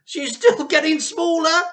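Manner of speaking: husband gleefully shouting at the shrinking. Acted. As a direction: laugh it out with glee. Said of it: "shouted Mister Cranky gleefully".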